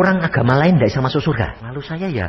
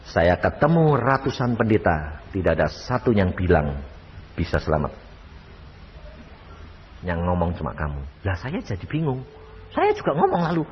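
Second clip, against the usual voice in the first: ordinary talk, nothing marked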